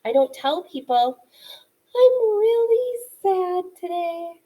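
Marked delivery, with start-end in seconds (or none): 1.9-4.4 higher and slow, a cheery voice that doesn't fit the words; mismatched sad claim. Shown as a demonstration. Teacher showing third graders a tone that doesn't match, said in a cheery sing-song. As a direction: say you're sad but sound bright and sing-song, tone not matching. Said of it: "My tone of voice and my facial expressions didn't match".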